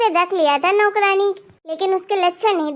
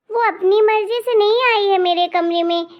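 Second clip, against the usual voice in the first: normal talking voice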